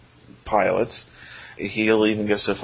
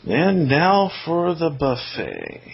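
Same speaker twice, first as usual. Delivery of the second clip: slow, louder grand announcement; announcer voice; 7 semitones higher, 4 dB louder, slower than usual. Acted. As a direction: stretch it out like a showman. Repeats segment intro, slowed.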